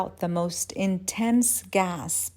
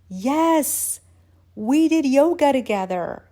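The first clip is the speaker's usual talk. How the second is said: raised, triumphant recognition; excited realization; 6 semitones higher, 6 dB louder than usual. Acted. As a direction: exclaim it as the memory lands. Excited realization while high.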